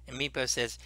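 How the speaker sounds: ordinary delivery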